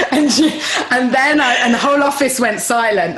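loud, high, words broken by laughter; laughing through words. Real, not acted. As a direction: keep laughing as you tell it. Laughing while retelling.

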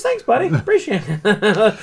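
high, bright-voiced mock thanks with a laugh; cheery thanks. Imitating the hockey player thanking a fan, high and cheery. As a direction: chirp a friendly thank-you, slowing at the end. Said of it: "He goes".

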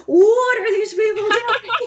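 high, loud, slow, laughing through the words; cracking up in disbelief at the show. Retold or imitated. Telling a friend about the crazy show, laughing disbelief.

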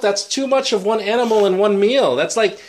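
high, loud exasperation; really bothered. Real, not acted. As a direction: object as if personally offended by the meal. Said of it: "You're really bothered by that".

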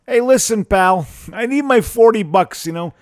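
loud, high mock cabbie demanding his money; gruff cabbie. Chicago cabbie hassling a fare for money, loud mock gruffness. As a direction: shake him down like a Chicago cabbie.

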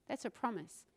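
usual speaking voice

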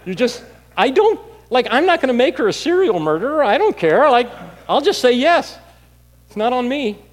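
high, loud, worked-up protest; exasperated rant. Retold or imitated. Worked-up comic rant recounting own reasoning.

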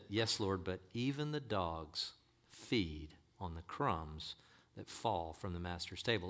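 meekly acted submission; humble reply. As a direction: concede it gently, unhurried. Voices the woman's humble reply.

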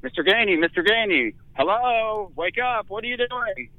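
high mock shouting of a name; retold shouting. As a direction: call him awake, sharp and high. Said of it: "starts shouting my name".

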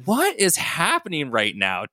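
high, slow baffled question; genuine bewilderment. A narrator's reading. Todd asks the others amid chaos, genuinely baffled. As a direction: ask it in honest confusion. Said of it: "Todd genuinely asked".

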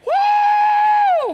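high, long, loud "woo"; a long rising party whoop. Shown as a demonstration. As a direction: whoop it up to show the crowd. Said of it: "give me a woo".

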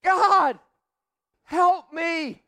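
high, loud, slow pleading cry; desperate plea to God. Shown as a demonstration. Believer begging God for help, high and desperate. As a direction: cry out to God like a helpless child. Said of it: "the next thing that you need to do is say".

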